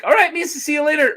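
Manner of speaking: put-on high, fast Gungan accent. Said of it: "Gungans who are like".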